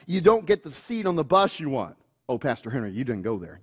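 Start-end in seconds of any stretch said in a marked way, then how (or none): 2.3-3.6 low, soft mock groan; groaning teen. Teen groaning at Pastor Henry, low soft mock dismay. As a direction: play the teen's dismay, understated.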